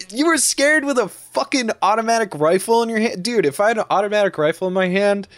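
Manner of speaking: incredulous. Real, not acted. Incredulous rant at shooter.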